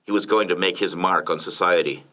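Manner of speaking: ordinary delivery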